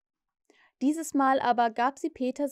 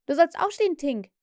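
higher, louder call through the door; boy calling to wake the fairy; 5 semitones higher, 5 dB louder than usual. A narrator's reading. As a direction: wake her with a shout. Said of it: "rief Nips".

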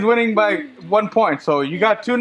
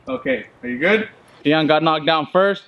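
ordinary delivery

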